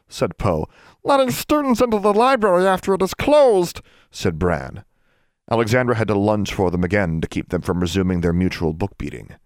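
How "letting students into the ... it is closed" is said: very high, frantic elf squeal; high-pitched distraught creature; wailing elf. A narrator's reading. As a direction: wail a guilty confession as an elf. Elves confess while punishing themselves.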